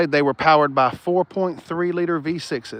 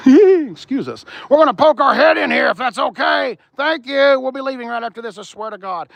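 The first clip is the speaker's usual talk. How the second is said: playful high-voiced pardon-me, fading at the end; mock-polite excuse; 7 semitones higher, 4 dB louder than usual. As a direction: pipe up sweetly as if intruding. Mock polite apology while poking in.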